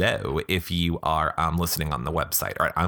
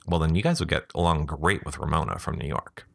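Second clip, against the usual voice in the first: ordinary delivery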